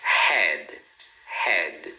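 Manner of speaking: HEAD low and falling, closed on D; clean final consonant. Shown as a demonstration. Teacher models word for repeating, correct pronunciation. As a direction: say "head" with nothing after the D. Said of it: "Olha outro exemplo".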